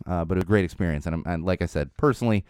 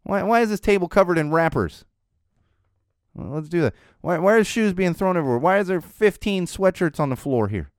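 exasperated questions; 8 semitones higher than usual. Retold or imitated. Father listing the kid's mess.

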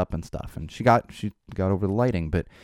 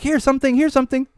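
high-pitched mock dog call; 4 dB louder than usual. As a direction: call a pet in a falsetto singsong. Mock calling a dog by name.

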